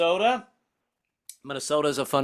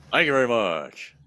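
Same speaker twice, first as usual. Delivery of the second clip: low, slurred impersonator's sign-off; 4 semitones lower than usual. Acted. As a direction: drop down and mumble it like the King. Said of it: "every [name] impersonator I've ever seen".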